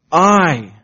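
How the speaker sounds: "I" loud and drawn out; preacher's forceful stress on "I"; emphatic I. Shown as a demonstration. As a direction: hit the word "I" hard, stretching it. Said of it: "it's emphatic in the Hebrew".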